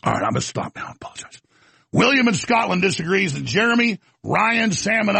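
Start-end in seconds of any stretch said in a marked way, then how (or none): 0.0-1.4 low, soft, falling away; subdued apology. Real, not acted. Host stopping his rant and apologizing, subdued. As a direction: back down quietly after an outburst.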